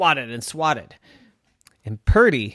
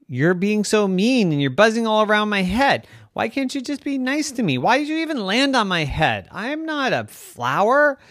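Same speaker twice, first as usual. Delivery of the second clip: high, whining kitty; upset cat; 10 semitones higher, 5 dB louder than usual. Acted. Cat Purdy scolding the bee, high whine. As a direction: plead like a hurt little cat. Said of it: "Purdy said".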